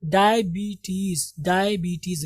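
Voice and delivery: डायबिटीज slowly, long BEE and TEEZ; correct pronunciation. Shown as a demonstration. Teacher answering own question for students, slow dai-bee-teez. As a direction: stretch both ees in डायबिटीज. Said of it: "क्या पढ़ेंगे".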